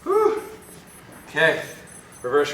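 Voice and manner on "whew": high breathy whew of relief; winded whew. Real, not acted. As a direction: blow out a tired whew. Tired exhale after exercise.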